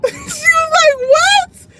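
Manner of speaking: high, loud laughing "what"; mock-shocked squeal through laughter; laughing shocked retelling. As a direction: crack up while mimicking a shocked friend. Laughing while retelling friend's shocked reply.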